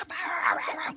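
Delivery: high, strained mock scream; mimicked screaming fit; imitated screaming. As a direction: rant in high gibberish. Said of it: "as I'm screaming".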